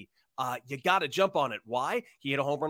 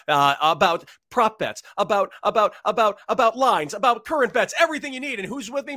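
excited; 5 semitones higher, 7 dB louder than usual. Real, not acted. Hyped intro, rising excitement.

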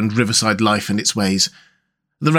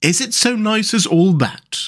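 higher, timid Mole character voice; shy; 9 semitones higher than usual. A narrator's reading. Mole asking Rat about boats, shy. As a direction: ask it shyly, pitch lifted. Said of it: "asked the Mole shyly".

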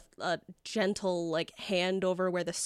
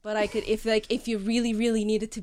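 louder, protesting Long Island wizard; sheepish Long Island accent; 4 semitones higher, 8 dB louder than usual. Acted. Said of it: "nods sheepishly and he says".

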